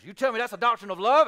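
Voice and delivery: loud, fast, disbelieving question; mock outrage at the claim; incredulous challenge. Preacher voicing a skeptic's disbelief, fast and loud. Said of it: "You say".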